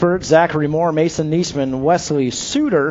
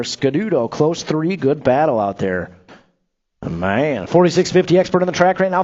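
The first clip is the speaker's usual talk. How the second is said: normal talking voice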